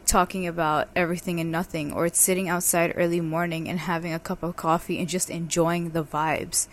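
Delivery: ordinary talk, nothing marked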